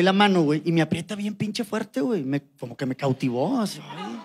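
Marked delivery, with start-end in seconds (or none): none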